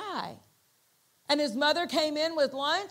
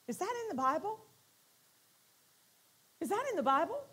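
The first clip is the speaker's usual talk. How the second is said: surprised question; 6 semitones higher, 4 dB quieter, much faster than usual. Retold or imitated. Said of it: "he said out loud".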